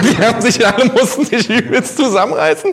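ordinary talk

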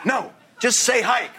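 exasperated director. Retold or imitated. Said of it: "He goes".

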